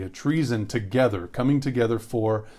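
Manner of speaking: usual speaking voice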